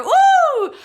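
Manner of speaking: high, loud celebratory whoop; excited woo. Real, not acted. Cheering the count of winners.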